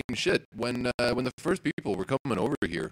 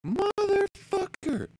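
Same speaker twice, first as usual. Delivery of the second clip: drawn out, much higher, in disbelief; exasperated curse; much slower than usual. Real, not acted. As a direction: stretch the curse out high and slow, fed up. Exasperated outburst at kneeling.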